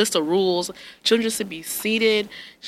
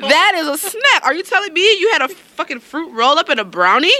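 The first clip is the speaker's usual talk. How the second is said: incredulous mom; 9 semitones higher than usual. Retold or imitated. Mother to young son about his lunch, incredulous and high. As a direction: drag out the disbelief at the son.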